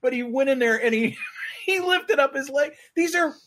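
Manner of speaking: high, giggly storytelling; laughing. Real, not acted. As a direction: keep telling it while fighting laughter. Teller still laughing at story.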